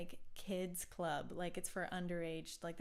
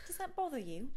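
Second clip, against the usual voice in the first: a hint of a polite Brit; lightly put-on courteous asking; mild mock British concern; polite British question; 6 semitones higher, 4 dB louder, faster than usual. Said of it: "they would never say that".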